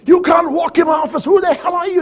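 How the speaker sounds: high-pitched angry yell, acting out an irate boss; screaming boss. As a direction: bellow at an intruder in your office. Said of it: "he starts screaming at me".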